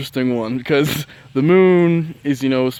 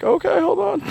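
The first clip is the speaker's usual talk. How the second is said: squeaky high hold-on; high nervous stall. Retold or imitated. Her stalling a clingy friend, high and nervous. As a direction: back away with a nervous squeak.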